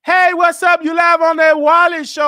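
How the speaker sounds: high, shouted host's welcome; loud on-air greeting. Real, not acted. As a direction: belt out a hello to the caller. Host greets new caller on air.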